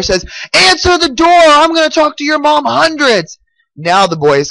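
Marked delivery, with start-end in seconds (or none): 0.5-3.4 high, threatening storybook demand; infuriated mayor. A narrator's reading. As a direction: act out angrily ordering someone to open up. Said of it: "the mayor is infuriated".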